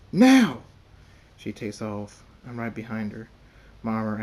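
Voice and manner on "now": very loud, high scream; full-volume high command; screamed order. A narrator's reading. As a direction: scream it urgently, one sharp burst. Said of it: "I scream again".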